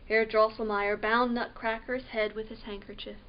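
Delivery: plain reading voice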